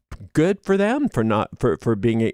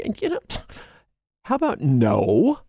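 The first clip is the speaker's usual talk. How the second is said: a jump way up then a flat how about no; sassy comeback to the bully; sassy refusal; 8 semitones higher than usual. Retold or imitated. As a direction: toss off a cheeky refusal. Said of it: "when you get angry enough".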